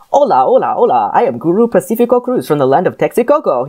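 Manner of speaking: channeling a suave Spanish actor; lilting Spanish-accented guru. Acted. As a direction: read it in a playful Spanish accent. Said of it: "read this in your Spanish accent".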